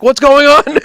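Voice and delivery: high, loud baffled question; bewildered. Retold or imitated. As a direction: ask it in retold bewilderment. Retelling bewildered question to coworker.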